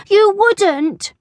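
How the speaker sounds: high, loud cornered schoolboy; acted playground alarm; alarmed protest. A narrator's reading. Cornered Nick faces tickling.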